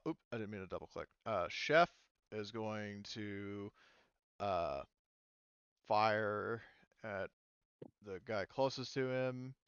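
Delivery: ordinary delivery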